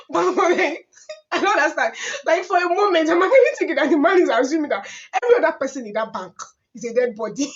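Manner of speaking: can't believe it, laughing as you talk; amused outrage at the mortuary caller; laughing disbelief. Real, not acted.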